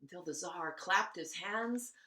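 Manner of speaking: usual speaking voice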